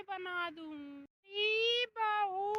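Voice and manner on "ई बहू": slow loud call to the bahu; mother-in-law calling the daughter-in-law loudly. Acted. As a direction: summon her sharply.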